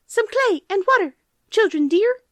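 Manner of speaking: very high, chirpy bird voices; chirping birds. Acted. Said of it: "birds chirped again".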